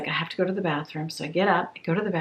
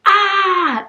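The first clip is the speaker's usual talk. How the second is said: short, high, loud scared shriek from the monster; mock monster scream; 14 semitones higher, 9 dB louder than usual. Telling a bat story, mimics the startled monster with a mock scream. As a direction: shriek back as the frightened monster. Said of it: "the monster sees them and goes".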